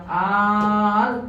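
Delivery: "al" with the a stretched out; long a. Shown as a demonstration. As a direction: hold the vowel of "al" long. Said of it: "yang a-nya dibaca panjang".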